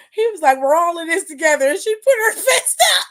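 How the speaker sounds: high, loud telling on the edge of laughter; loud, squealing amusement; cracking up. Real, not acted. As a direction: recount it while losing it laughing. Cracking up recounting press conference.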